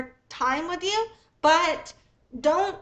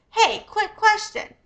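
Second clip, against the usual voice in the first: higher, louder, slowed-down cheerful hail to a stranger; friendly street pitch opener; 6 semitones higher, 7 dB louder, slower than usual. Shown as a demonstration. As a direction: call out to stop a passerby. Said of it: "the most common way this is done is by saying".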